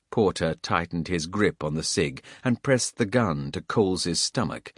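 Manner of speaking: plain reading voice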